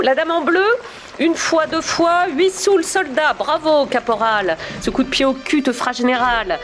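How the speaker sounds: bonimenteur aigu qui harangue la foule; crieur de vente aux enchères. Acted. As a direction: lancer les enchères comme un camelot de foire. Animateur joue un commissaire-priseur. (voix de commissaire-priseur enjoué)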